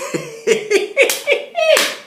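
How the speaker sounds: squealing laugh; high-pitched giggle; high burst of laughter. Real, not acted. Laughing at name.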